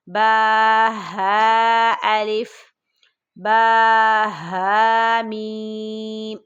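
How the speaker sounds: slow sung letter drill; heavy throaty ha. Shown as a demonstration. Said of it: "بھاری آواز میں".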